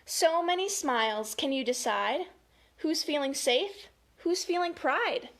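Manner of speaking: put-on lilting, emphatic read-aloud for children; story time delivery; picture-book reading. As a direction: read each feeling word out clearly, with lift.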